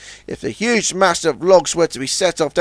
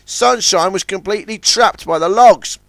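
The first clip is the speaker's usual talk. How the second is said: usual speaking voice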